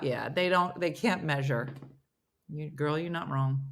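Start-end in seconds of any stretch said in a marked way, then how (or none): none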